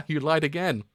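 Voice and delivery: delighted mocking laugh over the line; laughing gloat. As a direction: gloat with a cackle. Villain gloats through laughter.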